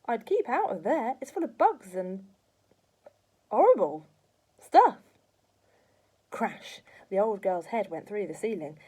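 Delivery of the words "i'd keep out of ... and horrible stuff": put-on louder and louder boy's warning; boy calling up to grandma. A narrator's reading. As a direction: build to a shouted warning.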